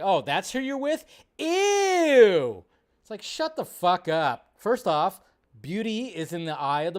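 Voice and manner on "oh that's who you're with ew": high loud grossed-out squeal; mocking disgust. Vlogger imitating snooty critics, high loud disgust. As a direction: fake shock then disgust. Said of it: "just goes like".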